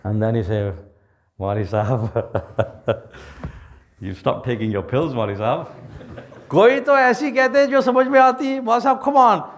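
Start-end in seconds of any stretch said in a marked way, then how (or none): 1.4-5.7 skeptical small fish. Acted. Small fish mocks the elder fish. 6.5-9.5 fast, higher scoff, brushing off the elder; scoffing disbelief. Acted. Little fish scoffing at the elder fish, fast and dismissive.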